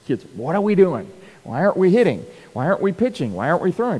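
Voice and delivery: hammed-up team griping at practice; mock kids' complaints. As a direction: players half-jokingly complaining they never hit. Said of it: "Every practice goes".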